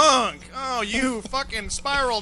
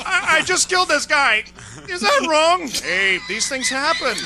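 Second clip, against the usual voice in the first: high and loud through laughter; laughing imitation of a panicked friend; laughing mock confession; 8 semitones higher, 6 dB louder, slower than usual. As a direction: giggle out the guilty question.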